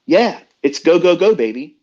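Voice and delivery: revved-up cheer. Retold or imitated. Host mimics racing-fuel rush, revved-up cheer. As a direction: pump it up like a race-car fan.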